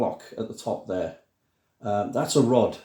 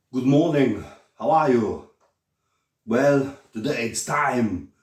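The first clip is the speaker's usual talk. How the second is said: loud, silly put-on greeting voice; stupid voice; 4 dB louder than usual. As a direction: boom out a daft, hammed-up good morning. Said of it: "to stop talking in a stupid voice".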